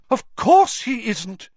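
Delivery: high, loud mystic teacher; excited. A narrator's reading. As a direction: gush with eager excitement. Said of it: "looking thoroughly excited".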